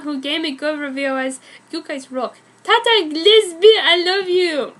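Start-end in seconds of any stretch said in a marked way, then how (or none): 2.6-4.7 loud, slow, gushing shout-out. Real, not acted. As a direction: gush over a favourite fan.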